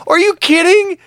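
high, incredulous outburst; mock indignant disbelief. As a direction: act out wounded outrage. Acts out offence at being called old.

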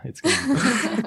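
ordinary talk, nothing marked

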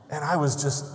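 ordinary talk

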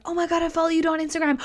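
high, quick gushing fan; fast starstruck fan. Retold or imitated. A fan meeting a celebrity, fast high gush. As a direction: blurt it out like an eager fan. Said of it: "goes into fan energy of".

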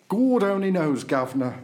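loud, high Cockney twang; Cockney accent. Shown as a demonstration. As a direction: put on a broad Cockney voice. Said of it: "That's my best Cockney accent".

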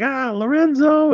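high, drawn-out wifely wail; exasperated wife. Retold or imitated. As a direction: imitate a wife protesting his name, slowly. Wife protesting to husband.

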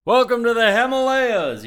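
high, loud goofy yeti greeting; big booming cheerful yeti; abominable snowman voice. Retold or imitated. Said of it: "sort of talk like the, uh, abominable snowman from Monsters, Inc".

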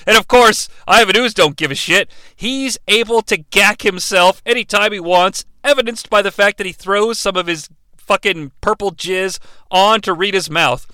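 ordinary talk

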